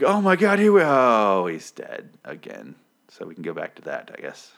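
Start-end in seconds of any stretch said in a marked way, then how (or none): 0.0-2.7 mock shock. Imagined viewers seeing him die, shock fading to deflation. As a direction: gasp loud, then let it drain away.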